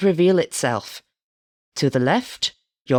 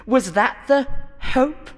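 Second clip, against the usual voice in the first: higher, sneering host taunts; 8 semitones higher than usual. A narrator's reading. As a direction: taunt the contestant. Host berates the contestant.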